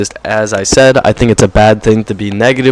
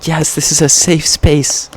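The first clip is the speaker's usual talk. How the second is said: mock poster voice; 5 semitones higher, slower than usual. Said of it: "scrolling through Instagram".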